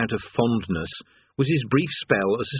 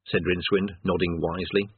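ordinary talk

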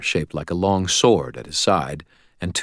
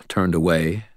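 ordinary delivery, nothing marked

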